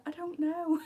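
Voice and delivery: high helpless mock whine. Answering Andrew about what to take, high helpless whine. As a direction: say it like a lost child. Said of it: "I'm like".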